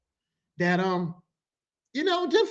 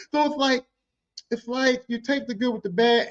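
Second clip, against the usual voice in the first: ordinary delivery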